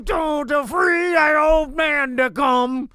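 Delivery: slow, high, growled rock-star croon; [name] impression. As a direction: drag each word out like a shock-rock frontman. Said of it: "That was a [name] impression".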